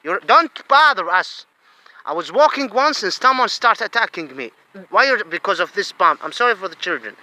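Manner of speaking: usual speaking voice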